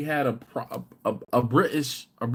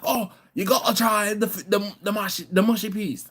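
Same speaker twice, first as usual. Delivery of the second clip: high, loud eager voice; hammed-up Chris; 9 semitones higher, 3 dB louder than usual. Friend urging mushy peas, high and eager. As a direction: play the host, pushing the peas hard. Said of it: "And he's like".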